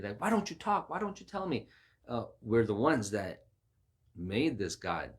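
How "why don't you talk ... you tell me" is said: high-pitched mock frustration; frustrated. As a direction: plead with a silent idol, exasperated. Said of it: "even if we're frustrated".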